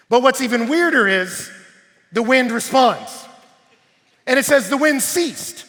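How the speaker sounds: loud, high, emphatic preacher delivery; full-force preaching. Acted. Preacher retelling disciples in a storm, full-force preaching. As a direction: drive each point out to the crowd.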